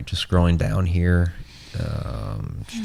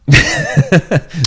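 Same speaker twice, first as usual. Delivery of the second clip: amused laugh; 10 semitones higher, 6 dB louder than usual. Real, not acted. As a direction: burst of laughter. Laughs at twisted plot.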